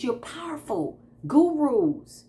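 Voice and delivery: ordinary delivery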